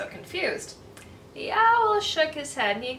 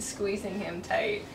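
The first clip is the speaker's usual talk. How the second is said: ordinary delivery, nothing marked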